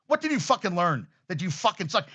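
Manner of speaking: ordinary talk, nothing marked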